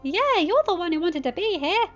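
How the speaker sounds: high, loud impatient shout. A narrator's reading. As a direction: shout it back at him, fed up. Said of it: "shouted Spike".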